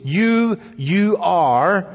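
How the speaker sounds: "You" high, then "you are" pushed; emphatic you. Shown as a demonstration. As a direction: stress both "you" words. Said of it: "So there's two "yous".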